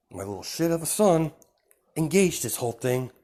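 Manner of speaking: ऊँची, थोड़ी तेज़ गुस्से वाली आवाज़; बेटे पर झुंझलाती माँ की तरह बोलो; mother's anger. Pretend. Angry mother blaming her son.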